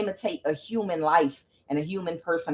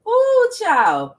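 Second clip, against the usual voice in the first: excited exclaim; 13 semitones higher, 7 dB louder than usual. Real, not acted. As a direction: let it burst out high and loud. Reacts to the card she drew.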